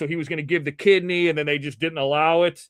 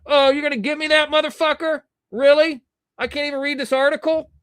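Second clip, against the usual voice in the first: indignant outburst; 9 semitones higher, 3 dB louder than usual. Real, not acted. Blocked from reading the article.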